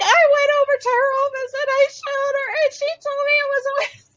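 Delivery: high, tearful retold wail; imitating crying woman. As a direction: voice her upset through tears, pitched high. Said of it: "someone was crying".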